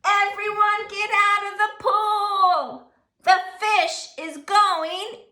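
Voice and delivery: high, loud, sung-out warning; fireman's yell. Acted. Fireman ordering swimmers out of the pool. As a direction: clear the pool with a shout. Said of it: "The fireman yelled".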